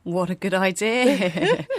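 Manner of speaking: loud, drawn-out amusement breaking into laughter; amused. Real, not acted. Co-host reacting to grown-ups' secret code, amused. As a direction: draw it out slowly, loudly, laughing at the end. Amused reply to co-host's childhood story.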